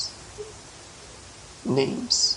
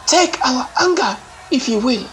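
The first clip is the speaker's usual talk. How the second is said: high, loud and unbending; iron-hard proclamation, much higher and louder; 9 semitones higher, 4 dB louder than usual. A narrator's reading. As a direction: hurl it out as a hard defiant cry. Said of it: "voice like iron".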